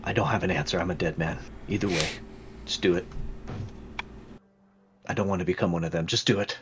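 low, soft voice of a doomed man; resigned captive. Acted. Captive asking to be killed, quietly resigned. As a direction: give up quietly, pitch dropped.

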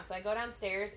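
normal talking voice

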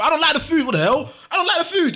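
high-pitched, exasperated complaint; worked-up, high retelling of a refusal; indignant protest. Retold or imitated. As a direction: protest like a kid refusing dinner. Acting out childhood protest at food.

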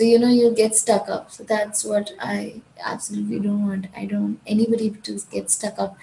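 ordinary talk

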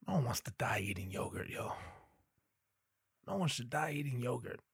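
whispered aside. Real, not acted. Comic to the audience about dying on yogurt, whispered. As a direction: drop to a hushed breath.

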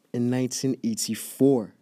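ordinary talk, nothing marked

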